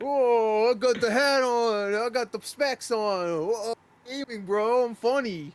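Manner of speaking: high mock gamer bro. Mocking a gamer streamer.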